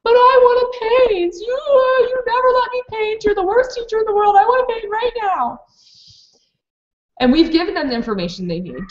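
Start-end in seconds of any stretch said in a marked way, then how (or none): 0.0-5.6 high-pitched mock tantrum wail; crying child. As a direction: whine and wail like a child denied. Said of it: "starts crying".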